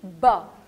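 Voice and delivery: ब as a loud, low "buh"; letter sound. Shown as a demonstration. Teacher answering what B says, punched letter sound. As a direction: punch ब out, dropping in pitch. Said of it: "B की sound क्या थी?".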